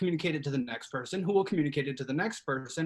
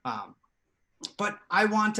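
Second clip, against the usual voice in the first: ordinary talk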